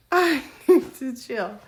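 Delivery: loud laughing, slowed down. Real, not acted. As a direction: laugh it out at yourself. Laughs at her hallucination.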